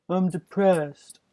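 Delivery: high, slow mocking whine of "I'm depressed". Mocking someone who excuses suicide, high slow whine.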